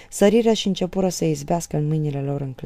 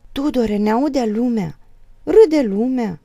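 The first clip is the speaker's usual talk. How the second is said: higher, louder, urgent appeal; alarmed pleading; 7 semitones higher, 7 dB louder, slower than usual. A narrator's reading. Wife fearing the neighbours will hear, alarmed pleading. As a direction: beg him to stop for shame. Mother pleads with raging husband.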